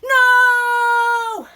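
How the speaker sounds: huge high panicked shout; emperor cries out. Acted. Emperor shouts no as the dragon threatens, high and very loud. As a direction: yell it in sheer terror of the dragon. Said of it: "The emperor cries out".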